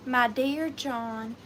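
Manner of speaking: put-on slow, drawn-out Southern accent. Seller opens the antique letter aloud, Southern accent. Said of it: "read it in a real Southern accent".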